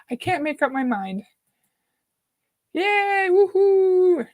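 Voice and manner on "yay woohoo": high, drawn-out little cheer; excited cheer. Real, not acted. Streamer cheers viewers joining the live, excited cheer. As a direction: cheer in a raised, lifted pitch.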